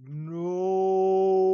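long drawn-out high, loud "no"; slow-motion scream. Acted. Manager running at me to stop me, slow-motion scream. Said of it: "everything moves in slow motion".

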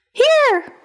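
the arrow pipes up from hiding, high and thin; magic arrow answering. Acted. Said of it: "answered the arrow".

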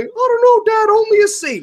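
sulky kid owning up to a bad grade; mock kid. Imagined son answers dad.

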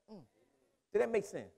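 ordinary talk

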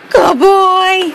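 ordinary talk, nothing marked

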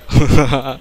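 short, loud laugh. Real, not acted. Player laughing at the game, short loud laugh. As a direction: burst out laughing briefly.

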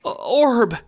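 high stammered cry of dismay. Acted. John crying out to his orb, dismayed stammer. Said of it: "you cry with dismay".

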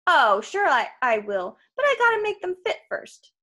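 salesman patter swooping high at the end; lightly voiced salesman, agreeable. Krusty putting off the boy, lightly salesman-like. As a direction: agree breezily, then add the catch.

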